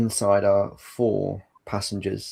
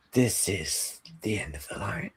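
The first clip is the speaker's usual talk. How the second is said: hushed role voice; 7 dB quieter than usual. Acted. Game master voices ominous train character.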